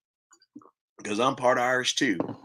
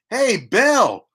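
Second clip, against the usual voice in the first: much higher, louder anxious priest voice; worried priest; 12 semitones higher, 7 dB louder, slower than usual. Retold or imitated. The priest comes to ask Bell for help, high anxious voice. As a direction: pitch it up like a rattled priest.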